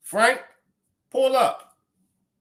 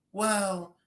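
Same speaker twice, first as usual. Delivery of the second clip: softly spoken, mealy imitation of a man without bass; mock him with a soft, drawn-out voice; mock weak-voiced man; 2 semitones higher, 5 dB quieter, slower than usual.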